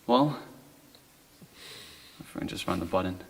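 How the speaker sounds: usual speaking voice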